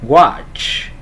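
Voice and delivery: "Watch" loud, ending in a released hissing ch; âm ch cuối. Shown as a demonstration. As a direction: push air out on the end of "Watch". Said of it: "Âm chờ nhá". ("Watch" đọc to, bật hơi ch cuối)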